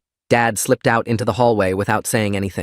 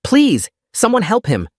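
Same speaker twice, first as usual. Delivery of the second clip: much higher, slow, desperate cry for help; hysterical plea; 12 semitones higher than usual. Acted. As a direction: beg the room to help him. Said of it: "Alice was hysterical".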